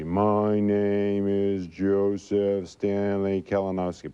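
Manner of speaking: slow, deliberate, each word spaced; slow careful name. Shown as a demonstration. Stutterer shows the slow taught way on air, slow and deliberate. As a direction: say your name slowly, the way you were taught. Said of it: "taught I couldn't say my name fast".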